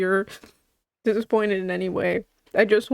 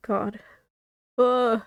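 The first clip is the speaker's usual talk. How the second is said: teary; 2 semitones higher, slower than usual. Real, not acted. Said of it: "stop crying".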